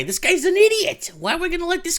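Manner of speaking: high, sneering put-on voice; mock executive. Imagined channel bosses dismissing him, high mocking voice. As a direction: lampoon a snooty bigwig.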